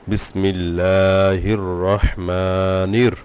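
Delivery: বিসমিল্লাহির রহ drawn out slowly in a chanting recitation tone. Shown as a demonstration. Teacher joining spelled letters into a slow Quran recitation. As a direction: recite the syllables, stretching each vowel. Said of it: "নুন র জের নির".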